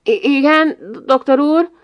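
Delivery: put-on a boy caught dreaming, answering the doctor; startled. A narrator's reading. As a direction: jump as if woken. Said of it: "összerezzent".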